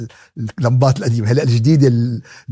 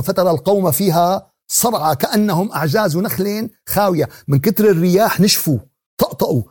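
fiery preaching; 6 semitones higher, 5 dB louder than usual. Acted. Vivid preaching about destroyed people.

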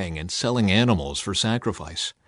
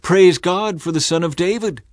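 raised, high call of praise; children shouting; 8 semitones higher, 5 dB louder than usual. A narrator's reading. Children in the temple shout praise, high and loud. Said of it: "children in the temple shouting".

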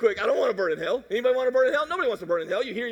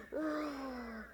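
breathy roar; 3 semitones higher, 13 dB quieter than usual. Pretend. Lion roar imitation.

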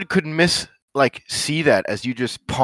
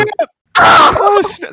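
high excited "Oh, snap!"; 6 dB louder, slower than usual. Real, not acted. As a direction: cheer the burn, pitch way up. Reacting to a good comeback.

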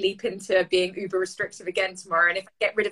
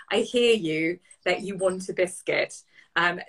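usual speaking voice

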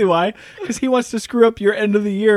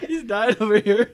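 laughing; 4 dB quieter than usual. Real, not acted. Laughing fit ongoing.

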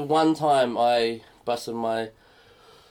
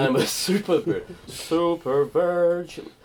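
mock proud super virgin; 4 semitones higher, slower than usual. Repeats friend's line slowly.

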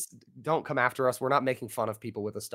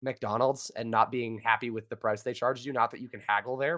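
usual speaking voice

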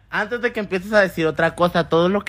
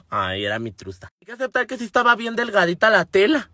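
ordinary talk, nothing marked

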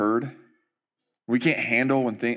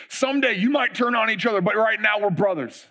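high, fast rallying voice; rally the troops; dramatic pep speech; 8 semitones higher than usual. Retold or imitated. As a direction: race starter rallying the runners. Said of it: "their whole speech".

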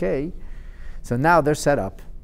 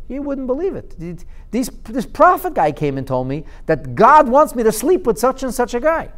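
indignant daughter; 9 semitones higher, 3 dB louder than usual. Acted. Daughter complains to her father.

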